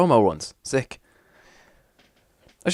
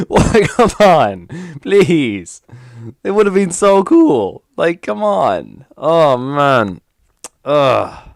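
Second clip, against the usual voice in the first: slow, high, louder whine of disappointment; 1 whining lament; 4 semitones higher, 4 dB louder, much slower than usual. Real, not acted. Streamer groaning at viewers over a lost combo, drawn-out whine. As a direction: stretch out the complaint, pleading.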